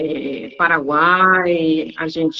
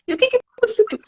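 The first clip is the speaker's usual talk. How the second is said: repeated probing question; 14 semitones higher, much faster than usual. Retold or imitated. Teacher repeats question to student, high and teasing. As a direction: ask it again with teasing curiosity, pitched high. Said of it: "Eu falo assim".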